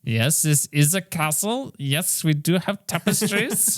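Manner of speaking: put-on a light Scottish accent, slowed, on "tapestries"; lightly voiced Scottish accent, drawing out "tapestries"; mock Scottish accent. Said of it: "Indy's, uh, Scottish accent".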